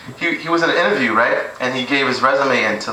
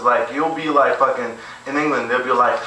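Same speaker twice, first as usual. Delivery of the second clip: ordinary talk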